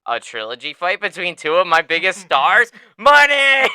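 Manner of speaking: rising to a gleeful shout on money; a promoter smelling cash, loud and high; greedy excited boss. Retold or imitated. Promoter Dana imagining the trilogy money, gleeful shout.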